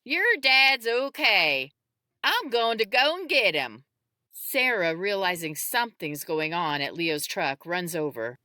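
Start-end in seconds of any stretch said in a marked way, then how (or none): 0.1-3.8 put-on raised, higher shout to a crying child; yelling. A narrator's reading. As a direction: call out reassurance at full voice. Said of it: "David hears him crying and yells".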